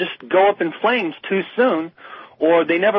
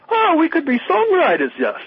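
higher-pitched imitation of dawning excitement; mock wide-eyed realization; 10 semitones higher than usual. Imitating young bands finding they can write songs, mock excitement. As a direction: act out a band's sudden discovery.

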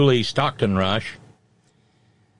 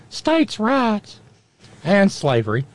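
high, thin, reedy Jefferson voice; pinched high voice, slowing at the end; 10 semitones higher, much slower than usual. Retold or imitated. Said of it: "High, thin, reedy voice".

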